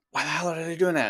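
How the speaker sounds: put-on muttered annoyance at what the kid is doing; irritated inner thought. Parent watching their kid, mildly exasperated inner voice. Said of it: "you're looking at your kid and it's like".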